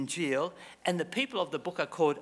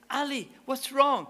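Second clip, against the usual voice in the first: high, louder alarmed question; 9 semitones higher, 5 dB louder than usual. Retold or imitated. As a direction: call out in alarm. Shopkeeper calls out worried.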